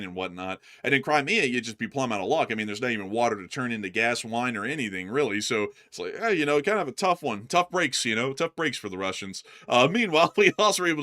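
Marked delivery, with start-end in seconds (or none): none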